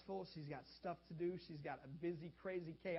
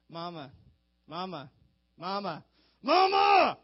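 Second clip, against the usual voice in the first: put-on a kid nagging louder each time until it's a yell; child calling mama; 18 dB louder, slower than usual. Child ignored by parent, calls louder, building to shout. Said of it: "just get louder and louder".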